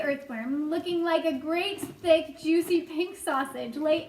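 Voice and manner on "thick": ordinary delivery, nothing marked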